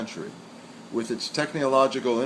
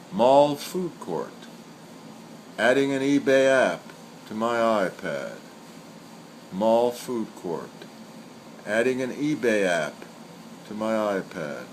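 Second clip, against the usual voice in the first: poem recital. Acted. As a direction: let each line of the poem hang before the next. Recites senryu twice.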